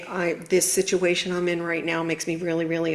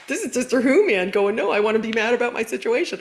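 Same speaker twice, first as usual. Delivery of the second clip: higher, quick mock whine; 4 semitones higher, faster than usual. Coach imitating her stubborn client, mock whiny. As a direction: play the client who wants to stay mad. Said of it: "And I'm like".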